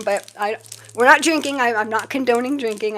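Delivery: usual speaking voice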